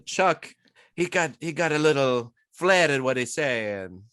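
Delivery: playful mock Italian-American accent with a laugh; imitated Italian-American accent. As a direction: poke fun at Chuck's Italian-American accent. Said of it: "make fun of somebody for their accent".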